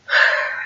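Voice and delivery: soft unvoiced nervous exhale; a soft, shaky steadying sigh; big nervous sigh. Real, not acted. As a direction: breathe out before walking up. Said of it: "I'm just like".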